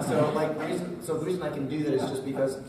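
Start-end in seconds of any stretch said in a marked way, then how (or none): none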